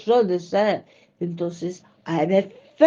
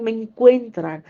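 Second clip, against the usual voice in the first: usual speaking voice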